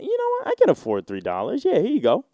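mock generous giver. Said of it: "I'd be like".

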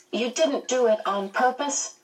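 high-pitched suspicious question; demanding child. A narrator's reading. Charles questioning the ladies, in a high, demanding voice. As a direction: press for an answer like a small boy. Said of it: "Charles demanded".

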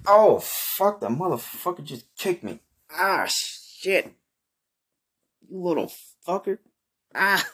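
high pained cries after a kick; pained yelp. Acted. Kicked by the donkey, high yelping pain.

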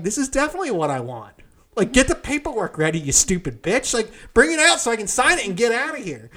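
telling managers to bring the paperwork; cocky dismissal. Retold or imitated. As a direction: sneer it at them. Teller's retold rude reply.

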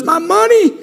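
a brother startled, lightly acted; alarmed brother. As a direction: show shock at finding the money. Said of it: "He said to his brothers".

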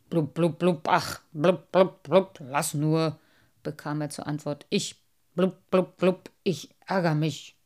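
sulking, spitting out blubs; grumpy old carp. Acted. Grumbling carp.

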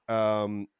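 usual speaking voice

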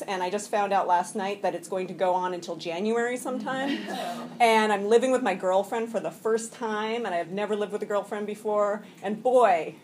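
ordinary talk

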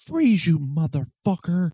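high, drawn-out mock yell; retold cop yelling a slow, high-pitched order; yelled police challenge. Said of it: "And, and I yell out".

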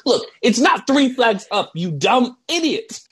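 high-pitched mock scolding; pissed-off coach. Coach arguing back and forth over the play, mock pissed. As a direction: imitate an exasperated coach, slowing on the insult. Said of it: "and then he was pissed".

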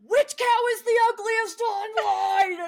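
high, loud, slow mock outrage; shrill-free but high hammed-up online voice; chaotic online persona. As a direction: belt it like a wild clickbait host. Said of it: "But online, she's like".

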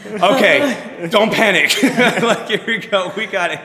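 high, giggly pep talk to herself; nervous laughing self-talk. Retold or imitated. Coach calming herself before big class, laughing.

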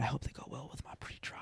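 hushed, quick whisper; mock secretive whisper. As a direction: lean in and whisper it. Coworker repeating line, whispered.

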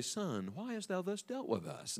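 very soft, mild scripture voice; mock-meek mother. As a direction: say it far too gently, as if nothing were wrong. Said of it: "I guarantee she did not say".

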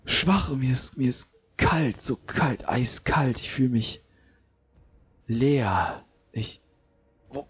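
high, frightened, weak just-woken voice; groggy and terrified. Acted. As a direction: wake up scared and cold. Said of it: "starrt dich entsetzt an".

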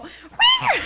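very high, loud imitated meow; piercing mock cat screech; screeching cat yowl. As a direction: let out a sudden attacking-cat shriek. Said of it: "you see this fucking cat go".

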